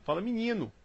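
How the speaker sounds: Rio accent, menino said as minino with an i in the first syllable. Shown as a demonstration. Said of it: "Aqui no Rio isso não se fala".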